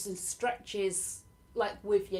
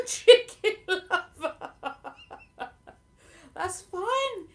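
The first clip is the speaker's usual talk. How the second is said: chicken lover laughed out slowly, pitched up; laughing; 9 semitones higher, 5 dB louder, much slower than usual. Real, not acted. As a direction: burst out laughing at the name. Laughing at a chat name.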